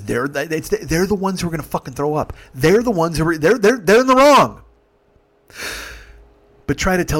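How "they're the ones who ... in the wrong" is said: stammering up to a high, loud protest; exasperated outburst. Real, not acted. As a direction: burst out in indignation, repeating the start. Exasperated at unfair treatment.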